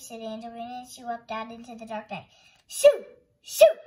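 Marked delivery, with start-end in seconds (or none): 2.7-3.8 very high, loud, short cries; shouted. A narrator's reading. Angelina facing Big Cat with her sword, shouting. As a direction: shout it to drive a beast away. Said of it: "she shouted".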